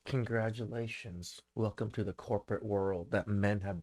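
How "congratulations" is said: soft, falling, deadpan congratulations; dry congratulations. Real, not acted. Reacting to a fired TikToker, deadpan and quiet.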